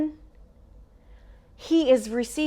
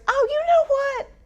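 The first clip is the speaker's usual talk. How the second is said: high, excited servant blurting out news; a breathless cupbearer rushing to tell the king; eager cupbearer; 8 semitones higher, 5 dB louder, faster than usual. Acted. Cupbearer voiced telling Pharaoh.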